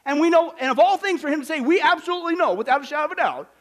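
ABSOLUTELY pitched high and driven loud; very emphatic. Acted. Preacher stressing what Paul absolutely knows, very emphatic. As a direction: hammer ABSOLUTELY KNOW, leaving no doubt. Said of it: "Very emphatic".

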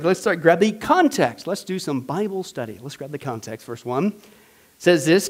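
ordinary talk, nothing marked

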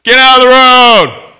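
loud, high, drawn-out yell; screamed warning. Acted. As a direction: scream an urgent warning down the street. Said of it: "and screamed at you".

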